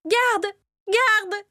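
a frightened queen crying out for her guards; calling for the guards. A narrator's reading. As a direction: shout for help in panic. Terrified queen calls the guards.

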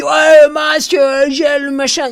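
high, loud mock bark of orders; volunteer yelling mask and gel at the runner, high mock shout. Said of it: "Il m'aboie dessus".

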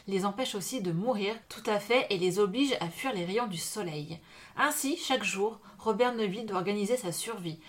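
measured reading voice, slowing at the end; dramatic back-cover reading; back cover read aloud. Acted. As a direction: read the blurb like a book trailer.

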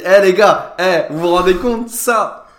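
crie haut et fort à la salle; interpellation au public. Retold or imitated. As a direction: lance la vanne, voix forte et aiguë. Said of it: "Des fois, je me dis". (humoriste qui harangue la salle, crié)

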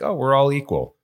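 high, sing-song mocking tone; mock naive voice. Mocking an American fallacy.

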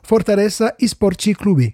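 slow, careful Brazilian Portuguese pronunciation; Portuguese accent. Shown as a demonstration. Co-host announces his accent before naming the club, Portuguese accent. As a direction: show off a Lusitanian accent, taking it slowly. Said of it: "Attention à mon accent lusitanien".

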